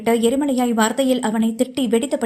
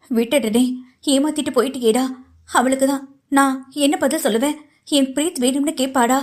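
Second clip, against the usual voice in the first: ordinary delivery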